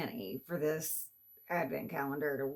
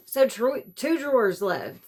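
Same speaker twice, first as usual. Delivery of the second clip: emphatic exclamation; 5 semitones higher, 8 dB louder than usual. Real, not acted. Exclaiming drawers left.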